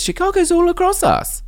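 thrilled at the listener's city; excited squeal. Real, not acted. Cohost reacting to a Chicago email, squealing excitedly.

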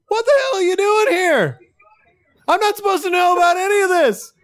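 high, strained, indignant yell; outraged yelling. Acted. Seinfeld character confronts the blood-covered intruder, outraged yelling.

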